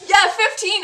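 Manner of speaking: high, loud excited announcement; thrilled. Real, not acted. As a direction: call out the lucky roll, thrilled. Announcing the lucky roll.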